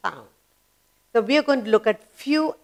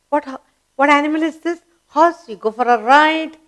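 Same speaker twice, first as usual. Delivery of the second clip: ordinary talk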